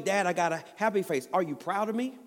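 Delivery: hint of an excited kid asking for praise; eager little boy. Father voices eager little son.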